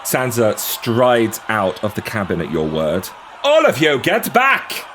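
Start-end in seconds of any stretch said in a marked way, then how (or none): 3.4-4.8 commanding call, higher and louder. Acted. Sansa to the crowd, commanding shout. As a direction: order them back. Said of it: "as she calls out".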